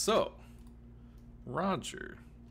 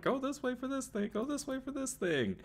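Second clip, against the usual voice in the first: squeaky sign voice; 5 dB quieter, faster than usual. Pretend. GM voicing the signs.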